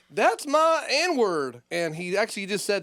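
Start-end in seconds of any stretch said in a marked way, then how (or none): 0.1-1.6 put-on drunk heckler drawl. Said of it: "he's like".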